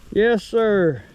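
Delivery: normal talking voice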